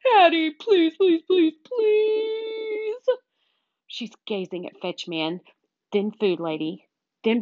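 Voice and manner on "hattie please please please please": high, insistent begging whine; hammed-up pleading, last word stretched out; whining. A narrator's reading. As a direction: whine like a desperate dog. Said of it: "I whine some more".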